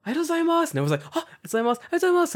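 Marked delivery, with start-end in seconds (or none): 0.1-0.7 put-on cute kid voice. Kid thanking him on train. 1.2-2.3 put-on high, bright kids' thank-yous piled on top of each other; chorus of kids. A crowd of kids thanking the foreigner, high-pitched and eager. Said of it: "it's like a chorus of arigatou gozaimasu".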